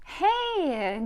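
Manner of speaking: high bright call with a laugh; playful protest; laughing outcry; laughing hey. Acted. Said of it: "Gigi laughs".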